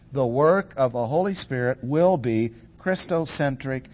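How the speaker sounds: normal talking voice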